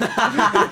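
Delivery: burst of laughter. Real, not acted. Relieved laugh.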